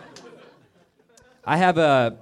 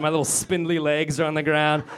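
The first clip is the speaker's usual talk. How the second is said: usual speaking voice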